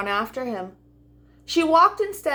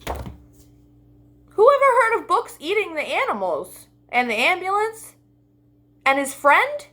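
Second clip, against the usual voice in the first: mock amazement; 6 semitones higher than usual. Real, not acted. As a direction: marvel at the silly book. Reader's amused comment.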